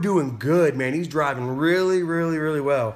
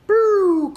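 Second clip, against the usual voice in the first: very high, loud whoosh; mock careening noise; 8 dB louder than usual. Storyteller mimicking a plunge into the ditch, high loud swoop. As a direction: imitate a car flying off the road.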